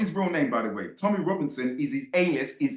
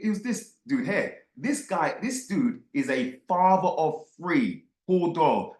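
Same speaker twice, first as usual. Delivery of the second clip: ordinary talk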